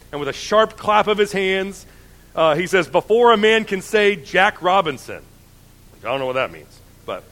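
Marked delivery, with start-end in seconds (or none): 0.1-5.2 lively storybook reading of Dickens; animated dramatic reading; reading aloud. Acted. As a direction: read it like a storyteller at Christmas. Reads Dickens passage aloud.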